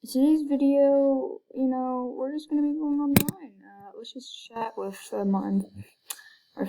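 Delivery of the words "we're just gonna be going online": ordinary talk, nothing marked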